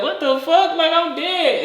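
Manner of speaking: high-pitched mock disbelief; bewildered. Dead person waking to applause, bewildered. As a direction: voice shoots up in comic confusion.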